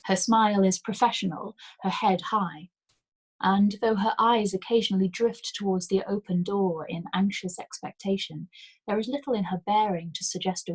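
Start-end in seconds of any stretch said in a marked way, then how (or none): none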